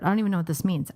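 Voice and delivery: normal talking voice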